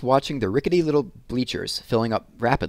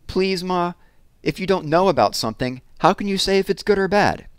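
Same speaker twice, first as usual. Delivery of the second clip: put-on higher, louder pleading boy; boy pleading with his mother; 10 semitones higher, 4 dB louder than usual. A narrator's reading. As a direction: beg your ma to come. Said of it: "Connie pleaded".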